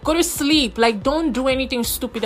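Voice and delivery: half-shouted plea to a friend. Retold or imitated. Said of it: "I'm like".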